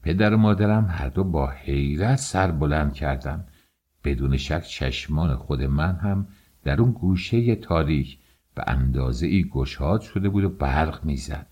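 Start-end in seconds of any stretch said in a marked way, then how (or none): none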